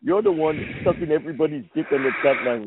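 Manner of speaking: normal talking voice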